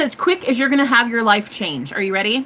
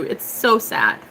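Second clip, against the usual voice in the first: ordinary talk, nothing marked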